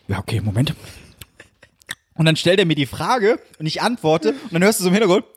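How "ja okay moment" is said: low dad stalling; imitated Hessian father. Father asking his son to wait, low imitated Hessian. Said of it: "andere Personen auf Hessisch nachmachst".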